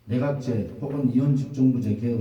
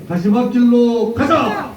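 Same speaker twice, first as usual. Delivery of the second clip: slow, high roaring slogan; roaring rallying speech; 11 semitones higher, 10 dB louder, slower than usual. Real, not acted. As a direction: roar each word to lead the crowd. Leader chants slogan, crowd repeats. (느리고 우렁찬 구호 외침)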